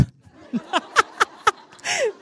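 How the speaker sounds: high, loud burst of laughter. Real, not acted. Preacher's wife laughing at the congregation, loud laugh. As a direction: cackle with delight.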